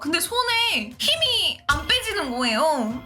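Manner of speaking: normal talking voice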